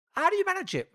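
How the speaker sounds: high-pitched, amazed question; incredulous questioning. Retold or imitated. Client marvelling at psychic's paid-off house, high incredulous retelling. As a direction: squeak up high as you re-enact asking.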